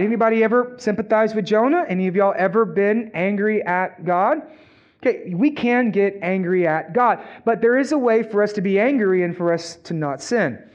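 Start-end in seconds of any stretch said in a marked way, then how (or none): none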